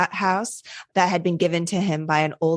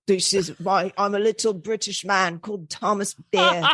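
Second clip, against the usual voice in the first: laughing British attempt. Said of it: "I can't do a British accent".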